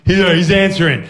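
usual speaking voice

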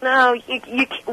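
ordinary delivery

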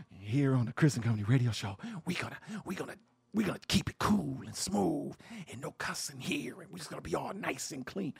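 smooth it out like a squeaky-clean radio host; mock proper radio voice. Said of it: "no matter if we speak proper".

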